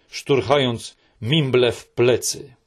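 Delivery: plain reading voice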